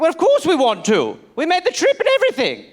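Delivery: hammed-up British accent, climbing high; old British gentleman. Colonist agreeing to split from the monarchy, high British accent. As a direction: agree like an eager colonial Englishman. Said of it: "He's like".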